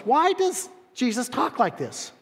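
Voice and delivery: high, indignant mock voice of the scribes; hammed-up outraged religious critic; scornful Bible experts. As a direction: sneer it like a smug critic. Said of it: "They were thinking to themselves".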